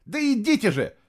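high, impatient call; shout. A narrator's reading. Boy running downstairs urges others on, calling high. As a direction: urge them on with a high cry. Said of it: "крикнул Фло".

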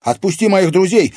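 put-on high, loud girl's demand; urgent high-pitched yell; defiant shout. A narrator's reading. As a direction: shout it at the captor. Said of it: "крикнула Катерина".

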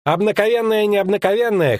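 high-pitched mocking lout; chuckling fat lout. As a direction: scoff in a high, jovial voice. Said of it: "хохотнул толстяк с чубом".

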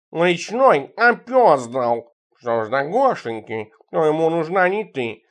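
slow, stretched-out cat voice; counterattacking cat. A narrator's reading. Cat Tikhon strikes back at the narrator, slow and riled. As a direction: draw the words out as a riled cat.

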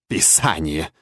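low croak of contempt; scornful witch's croak. A narrator's reading. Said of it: "презрительно каркнула ведьма". (презрительное карканье ведьмы)

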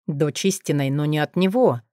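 ordinary delivery

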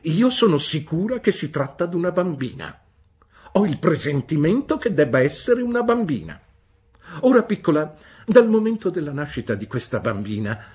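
high, loud, brusque "Bene?"; stern old aunt. A narrator's reading. Aunt Betsy demanding news from the mother, brusque. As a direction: demand an answer like a sharp old lady. Aunt asserts her premonition.

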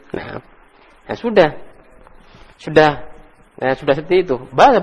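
ordinary talk

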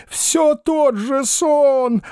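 very high, slow, theatrical lament; drawn-out high tragic cry; operatic despair. A narrator's reading. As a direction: bewail it like Boris Godunov on stage. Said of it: "с шаляпинскими интонациями".

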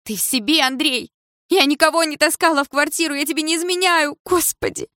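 high-pitched frantic protest; hysterical. A narrator's reading. Mother defending herself to her jealous husband, hysterical. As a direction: voice climbing into hysterical denial. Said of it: "В её голосе слышались истерические нотки".

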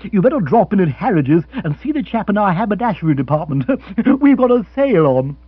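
loud, laughing sales pitch; teasing Rory. Acted. Rory teasing Bill about his clothes, laughing. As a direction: rib him with a chuckle.

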